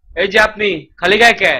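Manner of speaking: ordinary delivery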